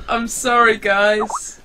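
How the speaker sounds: laughing apology. Real, not acted.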